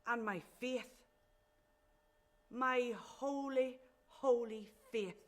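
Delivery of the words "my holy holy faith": louder, higher, each word drawn out slowly; slow, heavy scorn on holy; bitter emphasis. Acted. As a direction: draw out "holy, holy" with bitter force. Bitter lament about stifling religion.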